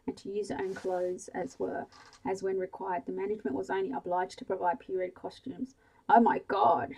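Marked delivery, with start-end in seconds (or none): 6.1-6.9 loud startled exclamation; gasp. Acted. Sally reading the theatre letter at breakfast, gasping. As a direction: gasp it out in shock. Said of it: "she gasped".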